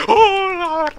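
high, loud gasp of surprise; startled gasp. Real, not acted. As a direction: caught off guard. Jump scare.

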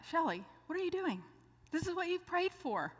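lightly voiced Lord chiding her; a hint of divine nudge; the Lord nudging.

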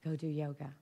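a quiet voice inside saying it gently; soft inner voice. Retold or imitated. Quiet inner voice urging her toward yoga, softly. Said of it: "some other voice said".

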